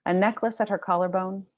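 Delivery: measured, pausing literary reading; story reading. Acted. Author reads her novel to the audience, steady narration. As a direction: read the excerpt like an audiobook, unhurried.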